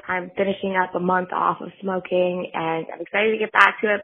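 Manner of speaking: usual speaking voice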